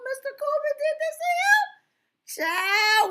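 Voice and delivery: high, loud disbelief; shocked exclamation. Real, not acted. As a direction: cry it out in disbelief. Reacting to Cuba Gooding allegation.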